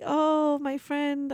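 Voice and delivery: normal talking voice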